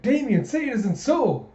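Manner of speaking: high, loud theatrical dismay; hammed-up dismay. [name] faking shock at Damien, high and theatrical. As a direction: ham up the betrayal.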